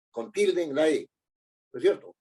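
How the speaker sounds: ordinary delivery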